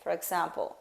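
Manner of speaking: usual speaking voice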